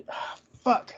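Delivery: breathy whispered "ah, fuck" under the breath; whispered curse. Real, not acted. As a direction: mutter the curse to yourself, frustrated. Stuck on a word, swearing.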